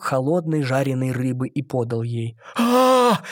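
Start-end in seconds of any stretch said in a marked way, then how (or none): 2.6-3.2 high, loud tearful "А!"; whiny wail. A narrator's reading. Mermaid wailing at the cooked fish. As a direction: wail like a spoilt child. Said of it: "закричала она плаксиво".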